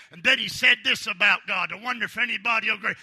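usual speaking voice

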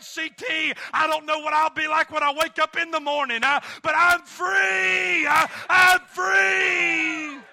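high ringing preacher's shout, slowing on free; triumphant shout. Retold or imitated. Preacher voicing his wife's freedom at the climax, triumphant shout. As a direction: belt out I'm free like a victory cry.

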